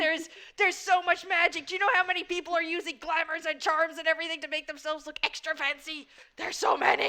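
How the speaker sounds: loud, high-pitched emphatic rant; excited exclaiming. Acted. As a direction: exclaim it, overwhelmed by how much. Excited rant about magic everywhere.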